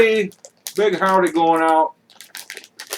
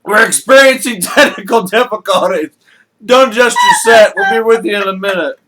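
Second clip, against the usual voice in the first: louder mock TV announcer; 3 semitones higher, 7 dB louder than usual. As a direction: play a TV station apology.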